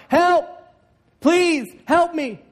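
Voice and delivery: high, loud cry from the bottom of a pit; desperate call for help. Acted. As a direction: shout up out of the hole, begging. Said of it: "he begins to call out".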